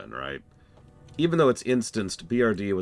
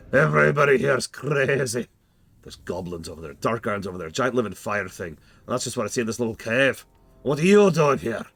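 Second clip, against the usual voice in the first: crazy NPC voice; 5 semitones higher, 6 dB louder than usual. Acted. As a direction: sell the madness, loud.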